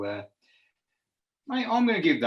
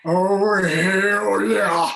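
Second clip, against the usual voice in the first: loud, high wrestling yell; Stone Cold catchphrase; 9 semitones higher, 9 dB louder, much slower than usual. Pretend. Beer name read, then loud wrestler yell. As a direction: roar it to the crowd. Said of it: "Stone Cold theme".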